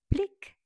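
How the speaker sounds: very high, loud popping plic; high bright pop; plic pop sound. Acted. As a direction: pop it out like a bud bursting. Flower pops open.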